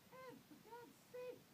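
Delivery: very high, slow exasperated cry; shouting. A narrator's reading. Mother begging father to stop, high cry. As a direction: wail his name in despair. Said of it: "And Mother shouted".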